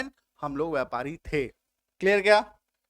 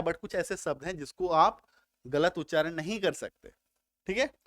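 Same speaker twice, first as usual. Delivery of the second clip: normal talking voice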